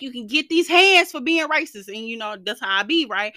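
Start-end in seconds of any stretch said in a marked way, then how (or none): 0.0-1.9 louder and higher, squaring up; mock threatening, like a tough thug; sassy fight-talk; tough threat. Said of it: "And I be like".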